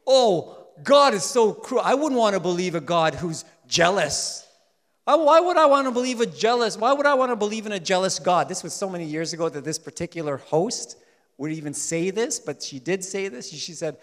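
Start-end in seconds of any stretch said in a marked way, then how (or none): 0.1-8.5 higher, louder indignant objection; imitated spokeslady. TV spokeslady objecting to a jealous God, imitated indignation. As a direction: mimic a TV host protesting loudly. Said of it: "one particular big spokeslady".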